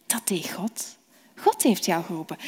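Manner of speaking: secretive whisper. Acted. Eli reveals who called.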